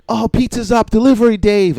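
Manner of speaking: high-pitched kitchen call-out; mock order-up call. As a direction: holler it out like a pizza cook.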